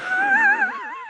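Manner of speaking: a squealing high-pitched giggle; high laugh. Real, not acted. Laughing after near loss of rod.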